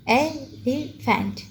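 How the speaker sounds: एलिफेंट slowly split ए, लि, फेंट; three separate beats in एलिफेंट; syllable by syllable. Shown as a demonstration. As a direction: pause between each piece of एलिफेंट. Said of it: "टुकड़ों में क्यों करते हैं".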